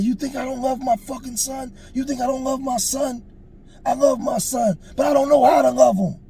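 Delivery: very high, impassioned protest building to a shout; acting out a distraught father's plea; anguished crying outburst, retold. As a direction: cry out in hurt and defiance. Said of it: "And he was crying".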